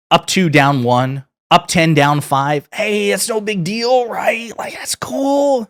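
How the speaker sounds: high, drawn-out fake casualness; hammed-up forced reassurance, slow and rising; mock hostage statement. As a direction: stretch it out, climbing into a strained high pitch. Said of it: "it looked like a hostage video".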